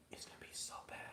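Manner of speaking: breathy secret whisper, almost no voice. Real, not acted. Talking to viewers about his new car, whispering. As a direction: let it slip out as a hushed secret.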